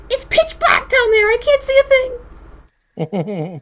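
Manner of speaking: very high squeaky exclaiming; creature voice. Acted.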